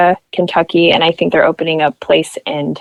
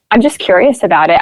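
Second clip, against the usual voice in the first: ordinary delivery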